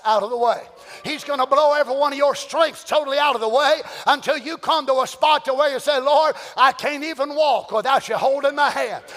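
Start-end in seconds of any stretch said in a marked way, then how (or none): 6.0-9.0 from pleading prayer to loud, rising proclamation; preacher voicing a prayer that swells into a shout; building preaching. Real, not acted. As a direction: build from despair to triumph. Preaching at full force, building.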